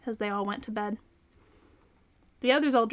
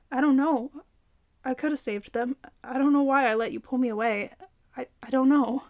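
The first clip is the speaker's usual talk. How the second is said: a touch of crying, higher and strained; tearful; 3 semitones higher, 4 dB louder than usual. A narrator's reading. Melly tells Tony she could have saved them, tearful. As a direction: choke out the guilt through tears. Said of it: "Melly was in tears".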